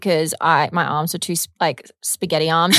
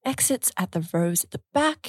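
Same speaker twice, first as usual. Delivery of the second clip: flight attendant; 7 dB quieter than usual. Acted. Mimicking cabin safety announcement.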